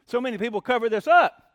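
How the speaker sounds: ordinary talk, nothing marked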